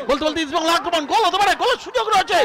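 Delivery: fast, high and loud; excited. Real, not acted. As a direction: rush it out, thrilled. Commentary on a goal chance.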